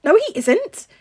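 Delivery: high, loud spluttered no; teen boy embarrassed, denying loudly and high; flustered denial. A narrator's reading. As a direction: deny it in a fluster. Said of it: "Peter spluttered".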